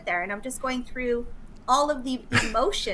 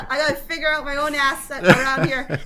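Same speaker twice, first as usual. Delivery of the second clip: laughing; 3 semitones higher, 4 dB louder than usual. Real, not acted. Laughs while retelling being stranded.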